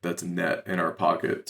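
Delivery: usual speaking voice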